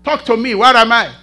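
loud, high preaching cry; fiery pulpit shout. Real, not acted. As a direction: call it out to the church at full force.

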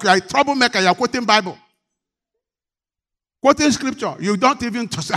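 loud and high, rebuking the congregation; fiery preacher scolding his flock; full-force preaching. Acted. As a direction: thunder the rebuke from the pulpit.

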